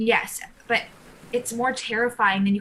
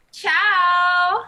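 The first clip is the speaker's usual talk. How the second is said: breezy goodbye; 8 semitones higher, 7 dB louder than usual. Acted.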